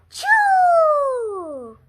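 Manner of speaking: big loud sneeze "chew". Acted. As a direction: belt out a huge sneeze. Gerald sneezing.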